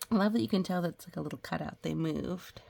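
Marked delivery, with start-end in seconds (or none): none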